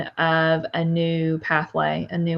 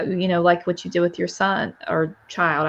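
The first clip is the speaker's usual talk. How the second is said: normal talking voice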